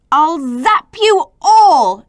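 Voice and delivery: Zed shouting his threat at everyone; shouted threat. Acted. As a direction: zap them all with a big slow yell. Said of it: "shouted Zed".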